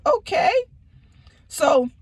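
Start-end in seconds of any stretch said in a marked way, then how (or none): 0.1-0.5 high, rising laughing "okay"; a pained, high little laugh; bitter laugh. Retold or imitated. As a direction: laugh it off in disbelief. Bitter reaction to betrayal.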